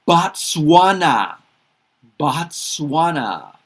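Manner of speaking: slow, deliberate "Botswana", every syllable clear; teacher modelling "Botswana"; careful pronunciation. Shown as a demonstration. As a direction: say "Botswana" slowly for learners to copy. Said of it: "teaching country names by repetition".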